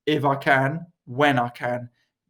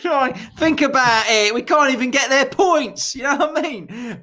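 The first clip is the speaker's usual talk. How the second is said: mock indignant rant; 8 semitones higher, 3 dB louder, slower than usual. Mock Brexit rant, Eurovision grudge.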